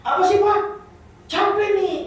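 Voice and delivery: high, loud whiny "Apa sih, Pak?"; grumpy grown child. Acted. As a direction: sulk like a teenager woken too early. Same child voice continues. (anak besar menggerutu pada bapaknya, suara tinggi dan keras)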